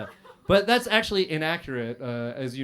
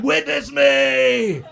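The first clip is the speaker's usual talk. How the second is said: slow, loud, high battle scream; imitating a war scream; 10 semitones higher, 9 dB louder, much slower than usual. Comic quoting the movie gang, screaming it. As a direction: drag it out like a warrior's cry. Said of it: "and scream".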